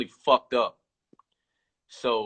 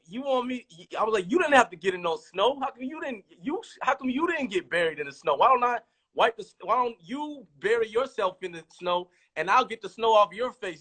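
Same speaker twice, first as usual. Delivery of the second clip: higher, incredulous arguing back; pushing back on NF, incredulous and higher; mock indignant protest; 8 semitones higher than usual. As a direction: push back on a ridiculous request. Retold argument with NF.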